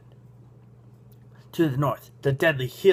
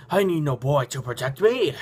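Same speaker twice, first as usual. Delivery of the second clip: huffing stranger; 2 semitones higher than usual. A narrator's reading. Stranger refusing a young escort, huffing. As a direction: huff it out, then gasp for air. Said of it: "the stranger huffs".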